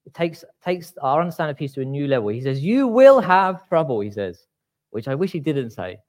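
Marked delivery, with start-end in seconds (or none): 2.6-4.0 loud, high, slow "will"; preacher stressing Jesus's warning, loud and slow; emphatic quote. Acted. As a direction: hammer "will" as certain. Quoting Jesus's hard words.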